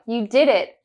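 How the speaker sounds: ordinary talk, nothing marked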